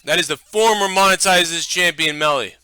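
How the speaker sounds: loud, high ring announcer call; wrestling announcer. Pretend. Announcing dog as champion.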